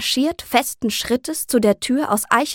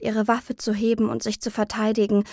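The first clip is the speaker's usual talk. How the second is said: usual speaking voice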